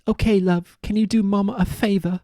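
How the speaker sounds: high-pitched British sugar mama; mock British accent. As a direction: put on a hammy British lady voice. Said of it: "Don't know why she's British".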